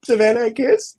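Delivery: lightly voiced little son; toddler imitated. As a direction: ask sweetly like a little boy. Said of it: "he's like".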